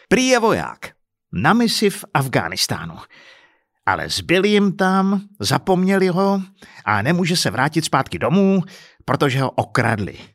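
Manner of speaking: high, indignant, incredulous account; scornful retelling. Acted. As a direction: recount it with angry disbelief. Husband scornfully retells the scam.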